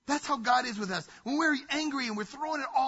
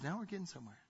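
soft, calm voice of God; God gently coaxing an angry child; gentle God; 4 semitones lower, 11 dB quieter, faster than usual. Retold or imitated. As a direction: answer patiently and kindly. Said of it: "And God says".

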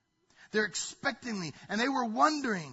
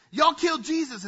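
loud, higher accusation; accusing; 8 semitones higher, 9 dB louder than usual. Retold or imitated. Peter accusing the crowd of killing Jesus, raised voice. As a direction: fling the blame at the crowd.

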